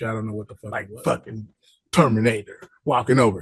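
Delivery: normal talking voice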